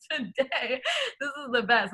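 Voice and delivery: words squeezed out through tears of laughter; real helpless laughter, near crying; laugh-crying while talking. Real, not acted. Laughing so hard she cries.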